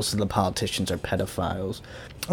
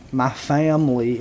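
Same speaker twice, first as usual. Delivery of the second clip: fake Southern accent; 3 semitones higher, 4 dB louder than usual. Coach faking a Southern accent for his new crowd, mock Southern drawl. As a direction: imitate a hammed-up Southern twang. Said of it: "I'll fake a Southern accent".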